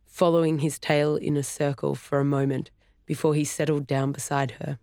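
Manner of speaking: plain reading voice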